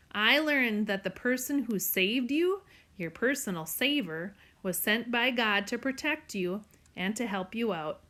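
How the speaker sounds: ordinary delivery, nothing marked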